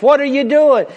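high, loud bewildered question; passerby asking. Retold or imitated. Passerby questions the man on the shore, high and loud. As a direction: challenge the starfish thrower. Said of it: "A guy came up to him and says".

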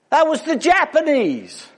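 high, emphatic correction; indignant objection. Acted. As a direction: set him straight, offended. Joke, Chinese man objects.

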